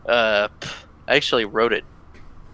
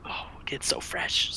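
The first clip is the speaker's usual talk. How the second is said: whisper; 13 dB quieter than usual. Real, not acted. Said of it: "whispering after a cough".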